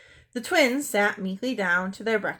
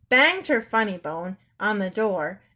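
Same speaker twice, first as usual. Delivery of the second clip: plain reading voice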